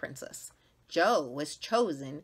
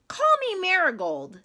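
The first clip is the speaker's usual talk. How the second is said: much higher, louder eager plea; eager girl; 14 semitones higher, 9 dB louder than usual. Acted. Jo wanting a flower name, high and eager. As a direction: beg to be a flower too. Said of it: "said Jo".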